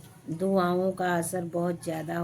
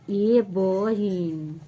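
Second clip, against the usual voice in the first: imitating nosy neighbour; 2 semitones higher, 5 dB louder, much slower than usual. Neighbour women asking the vlogger about gas, mock sweet. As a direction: stretch ये बहिन sweetly. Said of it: "ऐसे बोलते हैं".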